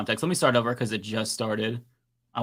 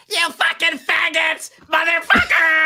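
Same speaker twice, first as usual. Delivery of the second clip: high, loud mock screaming at a crowd; angry protester impression; 7 dB louder, slower than usual. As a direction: screech it like the furious protester in the clip. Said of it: "that's a really good impression".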